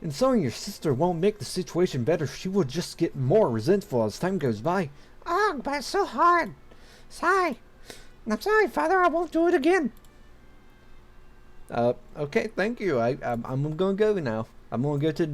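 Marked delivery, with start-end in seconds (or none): 5.2-9.9 put-on very high-pitched girl voice, a bit louder; high girl voice, sulky apology. Daughter apologising to her father, sulky squeaky high voice. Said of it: "Doing female voices is not my forte".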